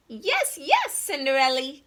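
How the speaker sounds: high excited yes yes; eager cry. Acted. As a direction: chirp it out eagerly. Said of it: "cried the mice".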